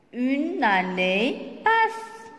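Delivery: Une année passe read very slowly, word by word; slow it right down for beginners; slow reading aloud. Shown as a demonstration. Teacher reads the sentence slowly to pupils. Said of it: "on va lire ensemble des phrases".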